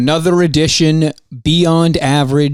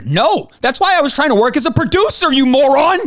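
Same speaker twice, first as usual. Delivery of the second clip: exasperated outburst; 9 semitones higher than usual. Real, not acted. Insulting Mike mid-rant.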